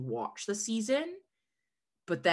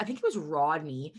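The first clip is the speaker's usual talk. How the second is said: ordinary talk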